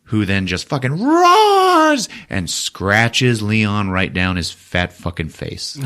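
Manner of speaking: ordinary talk, nothing marked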